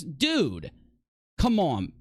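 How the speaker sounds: ordinary talk, nothing marked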